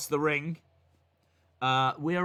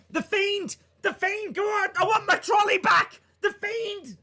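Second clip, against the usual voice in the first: much higher, loud, frantic yelling; shouted threat; 11 semitones higher, 3 dB louder than usual. Pretend. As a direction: scream the name to set her loose. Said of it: "You're like".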